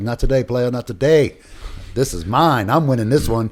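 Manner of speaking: rally against death out loud; defiant battle cry. Retold or imitated. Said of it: "I was like".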